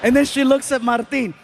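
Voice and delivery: normal talking voice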